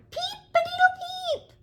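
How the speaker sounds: very high, loud squeaky crow; a tiny chick trying to crow like a rooster; chick's crow. Acted. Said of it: "tried again".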